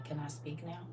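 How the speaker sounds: soft, hushed polite question; quiet question. Shown as a demonstration. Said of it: "And then you say".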